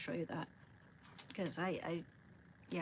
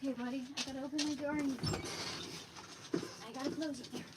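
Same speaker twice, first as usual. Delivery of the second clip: higher-pitched chatter to a little buddy; talking to buddy; 6 semitones higher, 3 dB quieter, faster than usual. Real, not acted. Speaker opens the door for buddy, high pet talk. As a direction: coax a pet along in a raised pitch. Talks to pet while moving.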